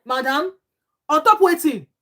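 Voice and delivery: loud, high-pitched challenge; indignant retort. Retold or imitated. Vlogger answering the table-slamming madam, loudly indignant. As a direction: call her out, voice jumping up. Acting own retort to the interviewee.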